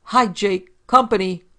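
loud yelled summons at full voice; screaming call. A narrator's reading. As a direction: bellow it to the back room. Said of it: "screamed, at the top of her voice".

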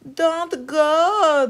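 high, loud worried mother; a mother begging her sons to stay; mother pleading. A narrator's reading. Mother begs her sons not to go, high pleading voice. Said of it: "their mother pleaded".

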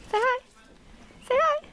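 very high coaxing voice; coaxing pony. Real, not acted. Coaxing a pony to say hi to the camera.

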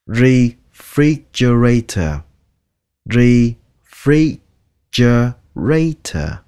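refrigerator very slow, in separate syllables. Shown as a demonstration. Teacher drills learners, slow separated syllables. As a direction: pull refrigerator apart piece by piece.

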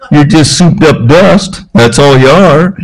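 Papa Don's voice. Retold or imitated. Preacher imitating his mentor's punchline to the congregation, lightly voiced. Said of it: "he talked like this".

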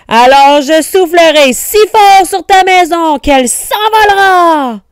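loud, high wolf bellow; angry storybook wolf at full volume; furious threat. A narrator's reading. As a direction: roar the threat, stretching the last word. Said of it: "très en colère".

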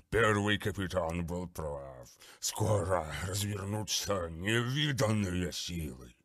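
slow, gurgling, contemptuous beast; gurgling monster voice. Acted. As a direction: sneer like a bloated daemon. Grulgar continues. (булькающий голос чудовища)

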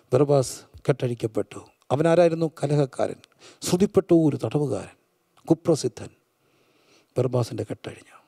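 low, soft, with long pauses; hushed preacher storytelling. Acted. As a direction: tell it gravely, almost in a whisper. Hushed dramatic storytelling in sermon.

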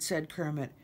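ordinary delivery, nothing marked

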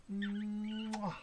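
long hummed build into a smack; drawn-out "mmm-wah" kiss; big kiss noise. Pretend. Reader and children give the fish a kiss, long smacking mwah. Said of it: "Can we give a kiss?".

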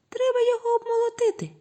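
very high, fast squeak; little mice voices. A narrator's reading. Mice tell rooster to thresh it, squeaky. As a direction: squeak it like a tiny mouse. Two mouse kids answer rooster together.

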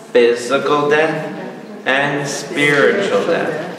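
very slow, drawn-out teaching delivery; measured and weighty, stretching each phrase; slow, deliberate emphasis. Real, not acted. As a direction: spell out each term slowly, word by word. Preacher spelling out two kinds of death.

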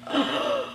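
very high, sharp startled gasp. Acted. Narrator gasping as pigs head for the house, high sharp gasp. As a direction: suck in air in shock.

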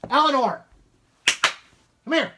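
loud high call across the house; calling the dog. Real, not acted. Owner calling the barking dog over, loud and high. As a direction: shout for the dog to come.

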